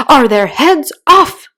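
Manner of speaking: loud, high, slow shout. A narrator's reading. Queen shouting to the soldiers about executions. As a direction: bellow the question across the garden. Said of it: "shouted the queen".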